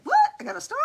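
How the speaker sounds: high-pitched, astonished questions; surprised reaction. Retold or imitated. Streamer mimicking her reaction to a star, high surprise. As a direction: act out your own startled delight. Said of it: "I'm like".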